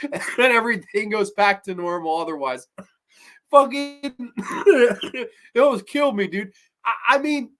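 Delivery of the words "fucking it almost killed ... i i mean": real laughter breaking up the words; laughing. Real, not acted. Reviewer cracking up at a silly ending, talking through laughter. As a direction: crack up mid-sentence, higher pitched.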